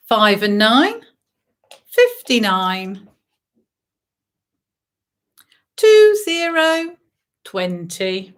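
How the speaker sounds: sing-song bingo number calling; bingo caller. Acted. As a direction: call each number out to the hall.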